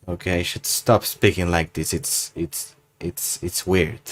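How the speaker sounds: soft, low self-doubting aside; self-conscious aside. Real, not acted. Embarrassed by own put-on voice.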